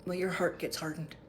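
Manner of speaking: ordinary delivery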